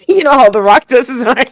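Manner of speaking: cracking up at the memory; laughing. Real, not acted. Laughing, asking about the arm.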